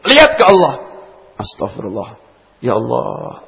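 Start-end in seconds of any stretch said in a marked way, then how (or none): 2.6-3.4 sincere prayer. Acted. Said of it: "coba pikir Allah".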